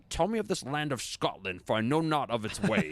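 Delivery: Sean Connery playing a Spaniard, quoted with a laugh; high, hammy Connery-style impression; imitating a highborn Spaniard asking about Scotland; mock Sean Connery line. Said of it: "he's like".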